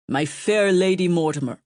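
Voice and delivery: smooth courtly king greeting her; a hint of a polished young king; polished accent. A narrator's reading. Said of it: "in a polished accent".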